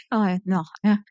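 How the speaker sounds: normal talking voice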